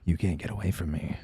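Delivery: low, slow, possessive purr; menacing tease. Pretend. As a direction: play the seductive villain. Villainous teasing.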